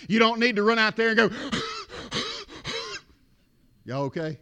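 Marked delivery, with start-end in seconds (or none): 1.3-2.4 quick, high, gulping gasps; hyperventilating gasps. Shown as a demonstration. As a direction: mimic panicked overbreathing in short gasps. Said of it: "try and hyperventilate".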